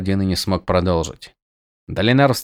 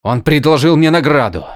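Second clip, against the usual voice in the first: louder, higher retort; snapping back; 7 semitones higher, 6 dB louder than usual. Acted. Prisoner snapping back at the prince, louder. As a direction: snap it back defensively. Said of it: "огрызнулся Каладин".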